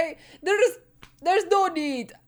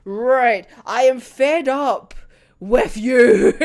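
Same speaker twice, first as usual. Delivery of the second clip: slow, louder fed-up complaint; a retold neighbour losing patience; fed-up telling off; 2 semitones lower, 7 dB louder, slower than usual. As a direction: lay down the law, exasperated. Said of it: "he just goes".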